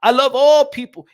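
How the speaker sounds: loud, high emphatic proclamation; proud declaration. Acted. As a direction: proclaim it with a big stretched ALL.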